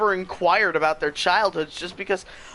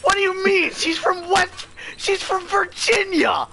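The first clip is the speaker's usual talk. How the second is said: much higher, near-yelling in disbelief; excited shout; 12 semitones higher than usual. Real, not acted. Arguing with friends about whether Virginia is southern, excited shout. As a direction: shout it in worked-up protest.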